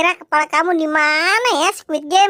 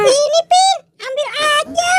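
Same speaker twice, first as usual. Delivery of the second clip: much higher, bright little character voice; high cartoon friend; 11 semitones higher than usual. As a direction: chirp like a small toy friend. Depu character voice. (suara tokoh kecil melengking)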